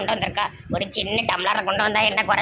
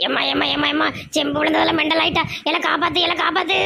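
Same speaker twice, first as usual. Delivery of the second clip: higher, faster frantic pleading; screaming to be saved, in a rush; panicked wail; 5 semitones higher, faster than usual. Acted. Mother-in-law fleeing the possessed daughter-in-law, panicked wail.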